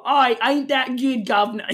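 high, hammed-up British accent; mock British accent. Co-host making fun of British people, mock British accent. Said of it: "when we make fun of British people".

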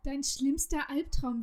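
ordinary delivery

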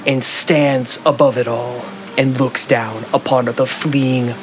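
steady scene-setting narrator; story narration. Acted. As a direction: paint the scene for the players. Game master's scene setting.

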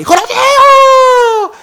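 shrieked, extremely high, drawn-out yell; hammed-up name-shouting. Driver echoing the player's name back, long shrieking mock cheer. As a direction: scream the name and hold it like a goal cry. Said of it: "Uma gritaria de nome".

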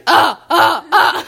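put-on loud strained baby grunts; retelling how she grunted in her crib as a baby; baby grunting. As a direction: grunt hard, like a baby hauling itself up. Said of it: "I'd be".

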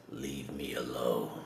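low, slow breathy whisper; imitating Hulk in a slow low whisper; whispered Hulk line. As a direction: hush it like a beaten giant. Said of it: "He says".

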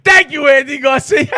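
high, loud gleeful boast; gleeful brag about the best sex in a month; excited retelling. Retold or imitated. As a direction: crow it out, high and loud, delighted with yourself. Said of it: "I said".

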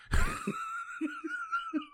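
long, high wheezy laugh; real squealing giggles trailing on; helpless laughing fit. Real, not acted. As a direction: crack up at your own crude joke. Cracks up at own crude joke.

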